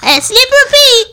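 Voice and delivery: calling a sleepy puppet awake. Real, not acted. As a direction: call him from across the room.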